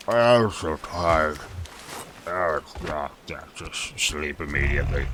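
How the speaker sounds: low, slow, drowsy monster voice; a hint of a yawning beast, low and soft; sleepy monster voice.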